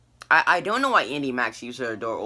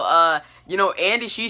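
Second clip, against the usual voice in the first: ordinary delivery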